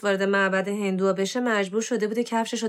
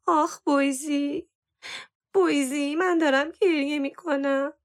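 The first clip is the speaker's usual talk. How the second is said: higher, drawn-out wailing moan; 7 semitones higher than usual. A narrator's reading. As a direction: moan it slowly, putting on tears. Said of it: "با ناله گفت".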